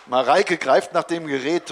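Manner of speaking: usual speaking voice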